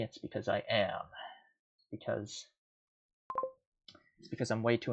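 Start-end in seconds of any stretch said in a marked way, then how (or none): none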